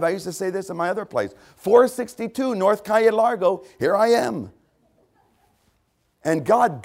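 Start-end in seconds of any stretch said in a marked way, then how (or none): 1.5-4.5 high, loud address call. Acted. Preacher giving God his old address, loud calling out. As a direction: call it up to the sky.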